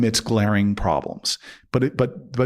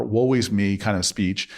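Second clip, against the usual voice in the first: ordinary delivery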